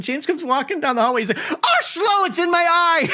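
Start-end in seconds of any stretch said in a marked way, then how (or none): 1.5-3.1 high-pitched imitation of a panicked yelp; mock panic. Coworker imitates James hit by breath cloud, mock panic. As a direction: cry out as if stung by a stink.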